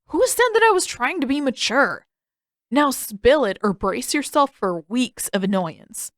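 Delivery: a lightly voiced cheeky Sokka. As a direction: push back with a smug tease.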